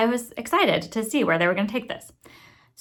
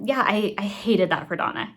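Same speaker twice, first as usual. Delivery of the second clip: ordinary talk, nothing marked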